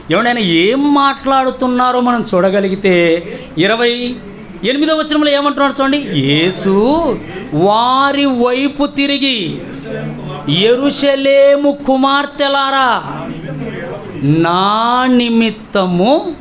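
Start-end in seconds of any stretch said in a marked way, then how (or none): 6.0-16.3 slow, stretched-out syllables with long pauses; solemn scripture reading. A narrator's reading. Preacher reads Jesus' words to the women of Jerusalem, solemn and drawn out. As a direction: declaim the verse very slowly, word by word.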